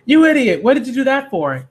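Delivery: high, loud outburst of disbelief; angry scolding. Acted. Pirate scolds boy who stabbed himself, angry yell. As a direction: yell at him for a stupid stunt.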